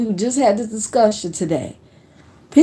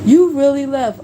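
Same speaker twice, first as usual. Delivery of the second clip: higher-pitched imitation of a doubting coworker; mock disbelief; 5 semitones higher, 4 dB louder than usual. Old coworkers doubting husband really quit, mocking imitation. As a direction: mimic their incredulous question, pitched up.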